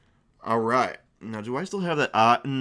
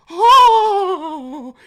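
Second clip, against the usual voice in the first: overjoyed cry; 12 dB louder than usual. Acted. Innkeeper overjoyed at the beer.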